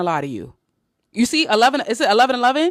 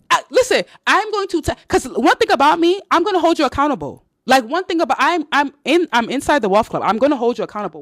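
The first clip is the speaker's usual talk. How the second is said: hyped up; 8 semitones higher, 5 dB louder than usual. Real, not acted. Excited promise to hold accountable.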